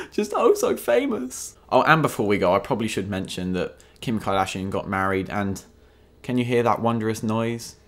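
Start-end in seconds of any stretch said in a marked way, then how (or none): 0.0-1.6 gasp, then high, slow, fake pity; a breathy gasp and a much higher mocking lilt; mock sympathy. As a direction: swoon sarcastically over fame. Sarcastic pity for celebrities.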